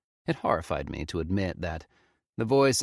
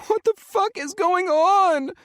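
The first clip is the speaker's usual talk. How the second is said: sobbing; 8 dB louder, slower than usual. Acted.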